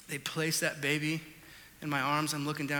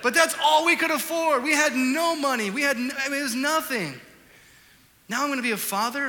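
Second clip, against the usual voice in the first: loud, higher worried rant; retold alarm; 8 semitones higher, 7 dB louder than usual. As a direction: build the panic about money. Retelling fear of fatherhood.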